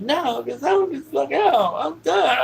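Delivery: very high, loud protest; throwing a fit. Retold or imitated. Child stomping and defying dad, tantrum. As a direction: imitate a child's defiant tantrum yell. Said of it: "I started throwing a fit".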